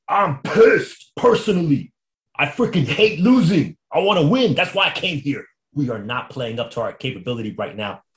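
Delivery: high, loud, angry ranting quote; hammed-up athlete fuming at losing; mock angry rant. Pitcher fuming to reporters after a loss, loud and angry.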